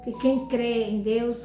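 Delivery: ordinary delivery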